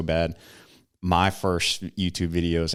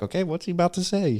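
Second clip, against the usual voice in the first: curious viewer; 10 semitones higher, faster than usual. Retold or imitated. Imitating a hooked viewer.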